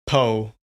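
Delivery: "Po" for pho, with a P sound; alternate pronunciation. Shown as a demonstration. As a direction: say "Po" like "poe". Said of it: "some people pronounce it".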